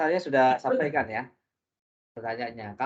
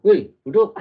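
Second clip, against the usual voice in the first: mock loud call; 7 semitones higher, 12 dB louder than usual. Retold call to sit, told not to speak.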